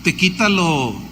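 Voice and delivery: usual speaking voice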